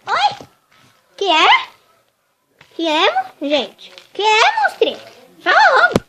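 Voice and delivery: high, loud grumpy wake-up voice; irritated snapping. Acted. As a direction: snap at them for waking you. Woken doll snaps irritably.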